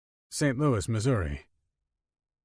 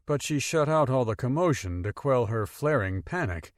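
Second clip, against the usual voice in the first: ordinary delivery, nothing marked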